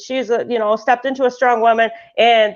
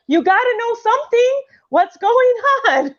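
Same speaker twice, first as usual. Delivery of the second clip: much higher, insistent mother; pressing mom; 11 semitones higher than usual. Retold or imitated. Mother pressing her daughter for a reason, higher and insistent. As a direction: push the child for an answer. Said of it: "she was like".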